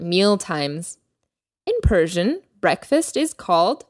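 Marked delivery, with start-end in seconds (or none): none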